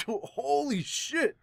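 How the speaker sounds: slow, high laughing exclamation; laughing disbelief. Real, not acted. Fan reacting to the chapter, laughing high and slow.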